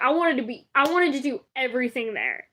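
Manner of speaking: ordinary talk, nothing marked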